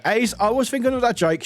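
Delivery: ordinary talk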